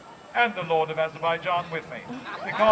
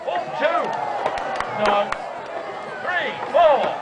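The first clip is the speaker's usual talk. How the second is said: higher, louder eager count; thrilled tally; excited counting; 5 semitones higher, 3 dB louder than usual. Real, not acted. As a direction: count them off with rising excitement. Counting arrows over the wall.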